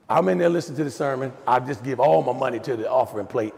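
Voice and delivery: ordinary talk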